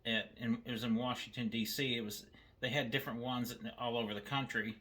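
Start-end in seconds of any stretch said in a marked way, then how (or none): none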